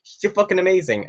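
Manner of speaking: lightly acted enthusiasm; imitated gushing lecturer. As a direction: imitate a lecturer raving about a favourite. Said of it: "she was like".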